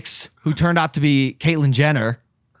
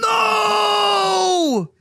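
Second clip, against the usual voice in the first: retold denial shout. Retelling his reveal to the judge, a high shouted No. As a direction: yelp it out in mock protest. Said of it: "And I was like".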